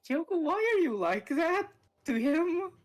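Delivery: high, loud exasperated question; exasperated outburst. Real, not acted. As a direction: call her out, fed up. Exasperated at a character.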